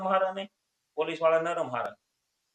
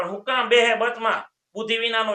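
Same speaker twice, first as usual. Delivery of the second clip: loud, higher angry scold; angry doctor scolding; 4 semitones higher, 6 dB louder than usual. Acted. Temperamental doctor scolds milk-drinking patient, loud angry rebuke.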